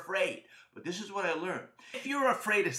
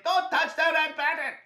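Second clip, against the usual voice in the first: high, loud panicked yell; Josh yelling; 10 semitones higher, 5 dB louder than usual. Acted. Friend's remembered warning about red button, yelling. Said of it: "I can hear my friend Josh yelling".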